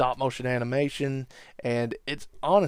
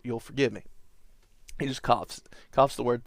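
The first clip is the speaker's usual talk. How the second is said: ordinary delivery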